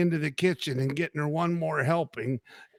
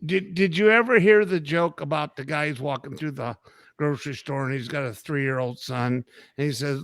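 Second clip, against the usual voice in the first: ordinary talk, nothing marked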